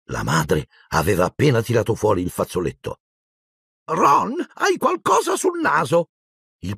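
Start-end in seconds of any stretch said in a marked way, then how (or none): 3.9-6.1 high, drawn-out motherly call; shrieky, slow maternal fussing. A narrator's reading. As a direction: point it out like a fussy mum. Fussing mother calls out to son.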